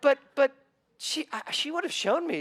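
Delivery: high, loud stammering objection; retold startled objection; flustered protest. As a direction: sputter out the protest. Protesting to his son.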